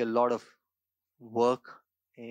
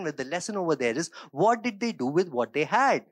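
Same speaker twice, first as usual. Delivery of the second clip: preaching at full force, raised and driving; fervent preaching; 5 semitones higher, 4 dB louder than usual. Acted. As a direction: press the message home to the congregation.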